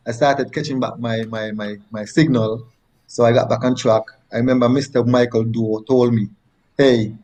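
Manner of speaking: ordinary talk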